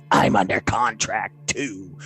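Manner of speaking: low, slow hissing reptile voice; reptilian hiss. Retold or imitated. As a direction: hiss it slowly like a cold lizard. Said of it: "Swan hisses in his reptilian voice".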